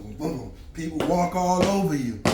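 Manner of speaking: ordinary delivery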